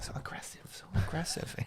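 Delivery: hushed, voiceless whisper; play-acted whispering; mock whispered aside. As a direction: whisper it as if shocked by the scuffle. Mock reaction to the pretend fight.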